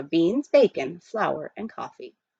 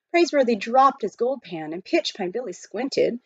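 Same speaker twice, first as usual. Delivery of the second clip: usual speaking voice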